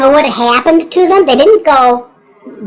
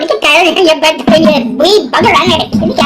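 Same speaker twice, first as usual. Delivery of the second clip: higher-pitched hammed-up Irish brogue; mock Irish; 5 semitones higher than usual. Irish over in Ireland threatening a spider, mock Irish brogue. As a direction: rattle it off as an angry Irishman. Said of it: "the Irish are over in Ireland going".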